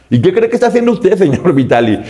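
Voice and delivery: high, scornful comeback; mocking villain retort. The kidnapper sneering back at Vitali, high and scornful. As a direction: throw it back in his face with a sneer.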